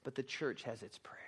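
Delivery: soft whisper; whispered point. Real, not acted. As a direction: drop to a whisper for the punchline. Preacher's climactic contrast.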